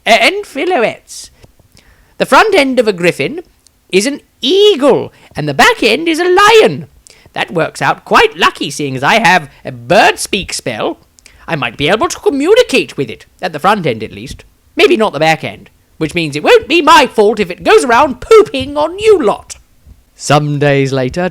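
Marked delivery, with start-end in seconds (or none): 0.0-1.3 high, slow courtly afterthought; he gallantly includes the ladies; Maestro adding. A narrator's reading. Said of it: "adding with consideration". 2.2-19.6 much higher, louder flamboyant lecturing; a showman explaining griffins with glee; Maestro, pompous bard. A narrator's reading.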